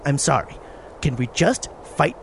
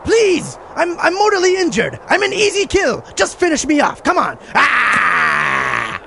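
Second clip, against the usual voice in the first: desperate wounded warrior; 5 semitones higher, slower than usual. Acted. Wounded warrior begs to die.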